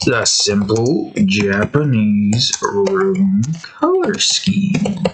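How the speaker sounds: stretched word by word, rising at the end; slow, drawn out. Real, not acted. Speaker looking up an image, spelling out the search slowly. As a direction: draw each word out at half speed.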